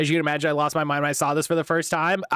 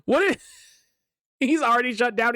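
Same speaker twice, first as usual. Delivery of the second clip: ordinary delivery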